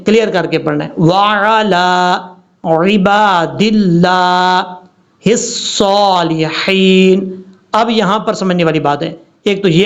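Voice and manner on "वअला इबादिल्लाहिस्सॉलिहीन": slow, drawn-out Quranic recitation; tajweed recitation. Shown as a demonstration. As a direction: recite each Arabic sound with care. Recites Arabic tajweed slowly. (धीमी तजवीद वाली तिलावत)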